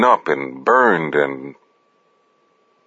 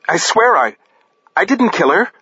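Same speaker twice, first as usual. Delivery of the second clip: pleading denial; 12 semitones higher than usual. A narrator's reading. Swears innocence in standoff.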